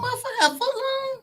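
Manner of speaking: very high, slow, laughing disbelief; shocked laugh. Real, not acted. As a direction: shriek it in disbelief. Shocked at a foot long.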